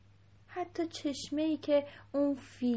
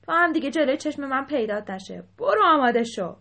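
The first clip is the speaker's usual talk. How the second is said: loud, stern dismissal; angry order; 3 semitones higher, 11 dB louder than usual. A narrator's reading. King banishing the elephant from sight, loud and cross. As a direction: send the offender off with a raised voice.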